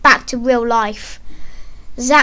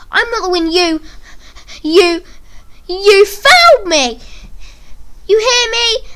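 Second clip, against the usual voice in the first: high, loud, stammering protest near tears; tearful yell; 7 semitones higher, 4 dB louder than usual. Acted. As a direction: yell it while fighting back tears. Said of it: "yelled, whilst unsuccessfully trying to hold back his tears".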